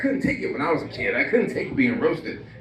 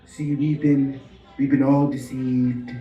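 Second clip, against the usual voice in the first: a hint of a president's speech; lightly voiced leader unveiling a conspiracy; mock president. Comic doing the president lightly, a mock address to the nation.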